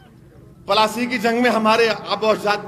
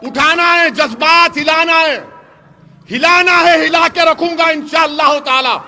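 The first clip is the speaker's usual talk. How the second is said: rising from firm oratory to a full rally shout; building to a shout; 6 semitones higher, 8 dB louder than usual. Acted. Politician stirring up his rally crowd, building to a shout. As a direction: whip the crowd up, louder and higher to the end.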